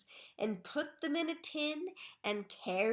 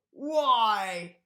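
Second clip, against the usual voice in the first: sharp loud question; 9 dB louder than usual. A narrator's reading. Mr Twit asks sharply.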